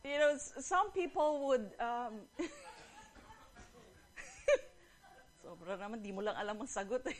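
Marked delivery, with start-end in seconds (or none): 2.3-3.3 soft breathless giggle; real wheezy chuckle; laughing. Real, not acted. Still laughing at the joke. 5.4-7.1 talking through giggles; amused, laughter in the voice; laughing. Real, not acted. Repeats punchline while still laughing.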